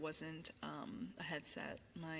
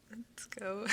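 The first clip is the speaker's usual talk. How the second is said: whispering; 2 semitones higher than usual. Acted. ASMR whisper.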